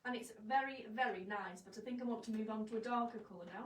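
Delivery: ordinary talk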